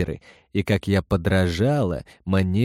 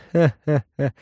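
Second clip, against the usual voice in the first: hysterical laugh; 5 semitones higher than usual. Acted. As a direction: burst out laughing at her. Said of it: "истерически расхохотался он". (истерический хохот)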